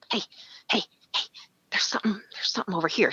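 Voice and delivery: hushed, hurried whisper for attention; urgent whisper. Retold or imitated. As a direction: whisper an urgent warning to a hunting partner. Woman secretly tapping him during hunt.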